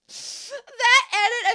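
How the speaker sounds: words pushed out through laughter, high and loud; laughing. Real, not acted. Host still laughing about the "Meh" edit, laughing. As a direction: keep laughing as you talk.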